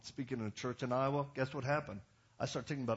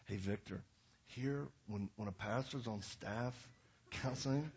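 real calm; 2 semitones lower, 5 dB quieter than usual. Retold or imitated. Senior pastor quietly correcting a young staffer, calm. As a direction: correct him calmly, with little volume and lowered pitch. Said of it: "he just said real calmly".

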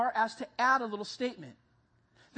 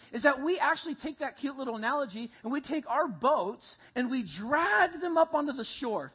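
ordinary talk

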